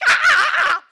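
sudden high, loud chimp shriek; a panicked chimpanzee scream, full out. Retold or imitated. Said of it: "That's not a very good chimpanzee noise".

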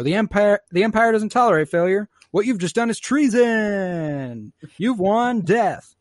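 theatrical villain proclamation, high and loud; Tarkin. Retold or imitated. Villain sentencing captured squad, hammed-up pronouncement. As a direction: stretch the final words grandly.

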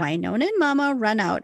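ordinary talk, nothing marked

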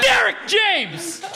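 high, slow, loud laughing announcer shout. Pretend. Graduation caller shouting Derek's name at diploma time. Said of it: "they were like".

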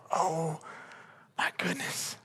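breathless, whispered oh my goodness; hushed dismay. Retold or imitated. People realizing they strayed, in a hushed gasp. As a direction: let it slip out in a stunned hush.

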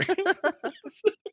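high-pitched laugh; giggling fit. Real, not acted. Host laughing while mimicking co-host, high laugh. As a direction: crack up at your own imitation.

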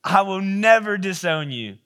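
loud, high vow, slowing at the end; emphatic insistence. Acted. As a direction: swear it with your chest puffed out. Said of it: "he insists emphatically".

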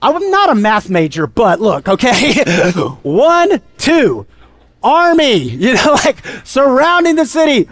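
comic disbelief, jumping up in pitch; incredulous servant. Acted. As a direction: play a servant who can't believe the odds. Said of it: "I'm sure Elisha's servant is like".

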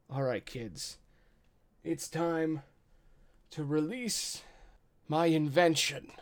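lightly voiced old kobold leader, halting delivery; older kobold leader. Old kobold leader to his kids before unleashing his invention, halting announcement. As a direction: address the kids in slow, separated phrases with a pause before each reveal. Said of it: "He's a little bit older than the other kobolds".

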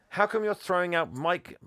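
lightly voiced indignant kid; challenging boy. Voices Jack challenging the boss.

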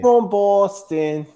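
put-on high, slow stab at a Boston accent; mock Boston accent. Friends joking on a podcast, trying a slow mock Boston accent. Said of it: "Boston accent".

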